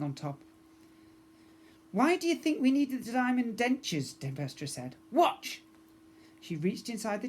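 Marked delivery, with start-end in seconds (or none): 1.9-4.2 put-on pirate girl voice. Pirate captain daughter. 5.1-5.6 commanding. Acted. Pirate daughter commands.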